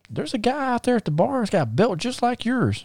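high-pitched cousin reporting news; high, urgent tattletale voice; excited cousin. Retold or imitated. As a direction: pipe it up high like an alarmed messenger.

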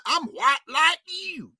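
slow, high put-on Southern hillbilly drawl; mock the candidate's fake Southern accent. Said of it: "now he got an accent like this".

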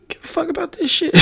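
high, loud mock voice; mocking other people. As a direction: squeal it out like a dismissive critic. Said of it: "people like".

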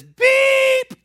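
loud, high denial with a bleeped curse; a fisherman shouting his denial, beep for the curse; angry shouted denial. Acted. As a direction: blow up at her, censoring yourself.

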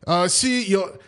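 high, slowing mock Ukrainian doctor accent; hammed-up Ukrainian-Irish accent. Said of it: "Can you tell us in that Ukrainian Irish accent".

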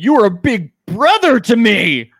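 hammed-up betrayed, high and loud; overacted wounded plea; mock heartbreak. Mock betrayal over miming food.

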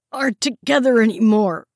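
loud, high, breathless answer; gasping panic. A narrator's reading. Tortured prisoner answering in a loud, breathless panic. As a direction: gasp it out in fear. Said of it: "Franklin gasped".

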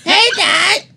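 high, sweet cartoon girl voice; Patty Mayonnaise voice. Doing Patty Mayonnaise's voice for friends, a high sweet cartoon girl. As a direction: imitate Patty greeting her dad. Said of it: "Her voice was like".

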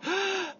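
quick, aggressive gasp in; snatch the air in one sharp gasp; big gasp. Shown as a demonstration. Runner demonstrating her first inhale, sharp aggressive gasp. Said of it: "really quick, really aggressive, big gasp in".

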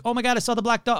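high-pitched phone-call alarm, played for laughs; mock panicked caller. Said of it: "they're on the phone like".

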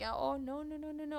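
normal talking voice

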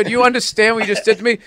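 high, fast mock-outraged husband; exasperated sitcom husband. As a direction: rattle it off in comic exasperation. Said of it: "felt like I was in a sitcom".

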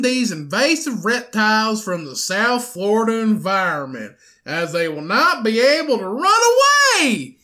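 put-on thick Southern Florida accent. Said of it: "in my best southern accent in Florida".

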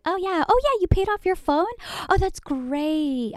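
high, syrupy customer service voice; fake sweet sales rep. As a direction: gush like a sugary phone agent. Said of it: "They were like".

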